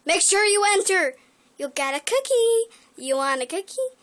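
high, loud and excited; excited shout. Real, not acted. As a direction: yell it out happily.

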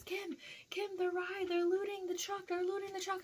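very high, panicky imitation; imitated alarm. Coworker warns Kim about looters, imitated high-pitched panic.

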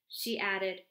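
ordinary delivery

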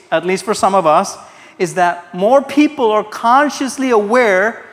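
emphatic preacher at high volume; forceful preaching. Real, not acted. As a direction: drive the hard truth home to the congregation.

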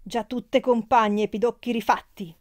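loud, drawn-out jeering shout; yelling. A narrator's reading. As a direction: hurl the insult slowly and loudly. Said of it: "gridò a squarciagola". (grido a squarciagola, lento)

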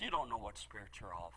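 quiet rebuke. Retold or imitated. Jesus rebuking disciples.

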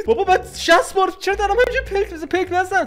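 high, loud panicked begging with a laugh; frantic pleading. Real, not acted. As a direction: beg yourself not to blink. Fighting his own urge to blink.

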